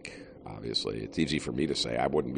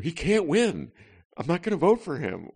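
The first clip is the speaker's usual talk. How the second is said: mock voter; 12 semitones higher, 4 dB louder than usual. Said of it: "the Chicago voter".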